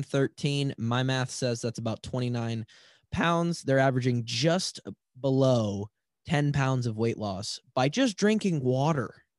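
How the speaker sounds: ordinary talk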